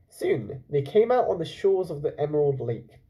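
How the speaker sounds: usual speaking voice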